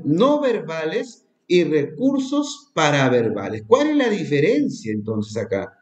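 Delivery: normal talking voice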